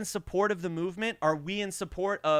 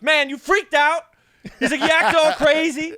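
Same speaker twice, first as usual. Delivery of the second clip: loud, higher teasing; relieved driver; 9 semitones higher, 10 dB louder than usual. Retold or imitated. Driver telling the narrator off, loud and high. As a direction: rib him loudly. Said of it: "he goes".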